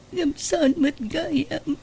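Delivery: slow, soft and high, the voice fading; a mother tells her child the family's happiness is gone, slow and soft; sad. Acted. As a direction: ask it as if the heart is breaking. Mother grieving family breaking up.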